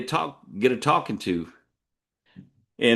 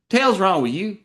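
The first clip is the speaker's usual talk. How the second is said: higher-pitched Texas scolding, mildly raised; loud retold reproach; 10 semitones higher, 4 dB louder than usual. Boyfriend scolding his girlfriend at the bar, mock loud reproach. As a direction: imitate a frustrated local calling her out. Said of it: "he said it loud".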